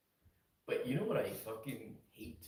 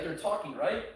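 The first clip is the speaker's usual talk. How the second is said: normal talking voice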